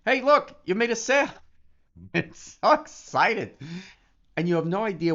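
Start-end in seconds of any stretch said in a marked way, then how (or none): 0.0-1.3 high, loud, delighted call; excited exclaim. Retold or imitated. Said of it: "this was another one". 2.1-3.6 excited. Real, not acted. Gushing about sales.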